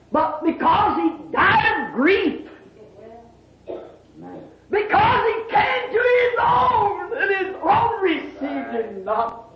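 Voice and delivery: high, ringing preacher's cry; impassioned sermon climax; fervent preaching. Real, not acted. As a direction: cry it out to the congregation, high then falling. Preaches at full force.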